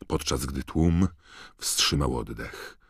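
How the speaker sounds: ordinary delivery, nothing marked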